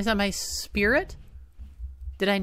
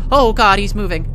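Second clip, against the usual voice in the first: frightened cry; 2 semitones higher, 10 dB louder than usual. Real, not acted. Monster moving toward him.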